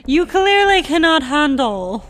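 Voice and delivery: mock posh British. Co-host voicing the British as pompous colonizers. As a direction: condescend like a pompous colonial officer. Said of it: "proper British ways".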